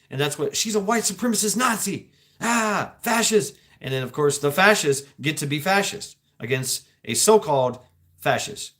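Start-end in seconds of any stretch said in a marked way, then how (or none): 0.5-3.6 high-pitched mimicked outcry; mock accusing protesters. Speaker mimicking protesters accusing an activist, mock outraged. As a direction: imitate outraged protesters hurling labels.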